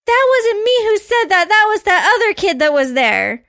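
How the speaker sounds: high-pitched mock tearful denial; lightly acted child's wail, pitch easing down; imitated crying boy. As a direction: plead like a kid starting to cry. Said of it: "this little boy just starts crying".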